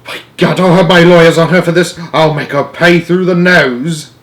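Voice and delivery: loud, high furious threat; furious vow. Acted. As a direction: swear revenge at full volume. Jenkins vows revenge.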